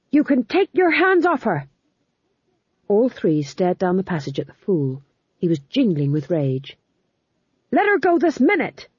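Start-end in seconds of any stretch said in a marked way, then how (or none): 0.1-1.7 much higher, louder indignant cry; a small man shouting with rage; furious fool. Acted. The fool yells at the guards, high and loud. 7.7-8.9 much higher, louder command; shouted. Acted. The fool shouts at the guards to release her. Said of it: "he shouted".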